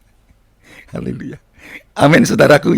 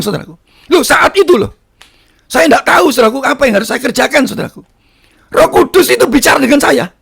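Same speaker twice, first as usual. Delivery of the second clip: high, urgent testimony delivery; fervent preaching; 9 semitones higher, 5 dB louder than usual. Acted. Preacher recounts grandmother's death to congregation, fervent preaching. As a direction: drive the story on at a raised pitch.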